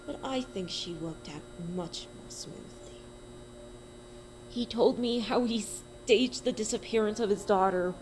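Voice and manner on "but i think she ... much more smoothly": a hint of a soft, cold doctor; lightly voiced, detached confession. A narrator's reading. Doctor boasting to the narrator, lightly voiced and soft.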